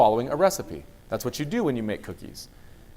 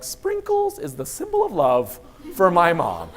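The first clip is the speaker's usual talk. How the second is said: sweet daughter voice; 6 semitones higher, 6 dB louder, slower than usual. Retold or imitated. Daughter voiced, slow laugh.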